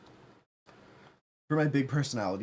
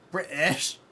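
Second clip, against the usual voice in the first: mock British accent; 6 semitones higher, 3 dB louder than usual. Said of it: "My British accent is a crime".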